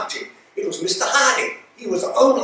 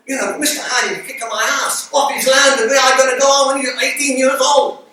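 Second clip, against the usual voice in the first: raised voice; 5 semitones higher, 5 dB louder than usual. Real, not acted. Indignant retelling.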